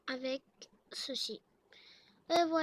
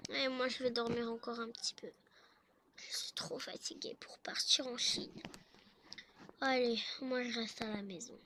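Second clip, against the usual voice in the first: lightly voiced tired man, lower toward the end; sleepy husband; 3 semitones lower, 4 dB quieter than usual. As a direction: trail off softly, wanting more sleep. Sleepy [name] refuses to leave. (mari fatigué qui ronchonne doucement)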